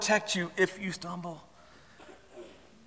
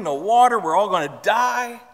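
higher, mock-doomed complaint; grumbling; 4 semitones higher, 4 dB louder than usual. Israelites grumbling over no water, mock complaint. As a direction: imitate a crowd griping. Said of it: "they're grumbling".

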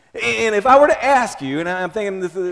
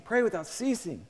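softer echo; 3 semitones higher, 9 dB quieter than usual. Shown as a demonstration. Preacher echoing the verse to the congregation, soft and hushed. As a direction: repeat it much softer, almost to yourself.

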